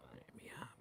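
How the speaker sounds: whispered in pain; barely voiced, hurting; susurro dolorido. Retelling painful physio massage. (susurrando de dolor)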